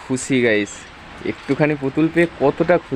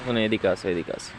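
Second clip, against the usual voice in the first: hushed, much softer call; speaking softly; 3 semitones lower, 8 dB quieter than usual. Real, not acted. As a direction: lower the voice, coaxing her over. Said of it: "আস্তে কথা বলো". (ফিসফিস করে নরম গলায় ডাকা)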